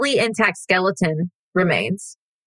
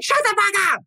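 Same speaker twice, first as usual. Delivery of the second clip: high yelled shut the fuck up; mock shout. Yelling back at the interviewer on TV, mock shout. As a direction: snap it out in a high yell. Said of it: "I was like".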